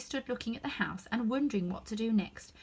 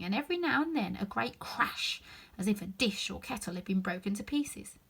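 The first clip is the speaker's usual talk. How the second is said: plain reading voice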